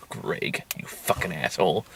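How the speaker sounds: low, soft grumbling; quiet, low mock grudge; muttered cursing. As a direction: curse them under your breath, mock-bitter. Mock cursing the ghost under breath.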